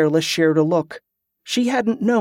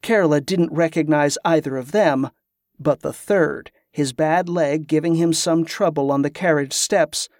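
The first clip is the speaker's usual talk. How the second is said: ordinary delivery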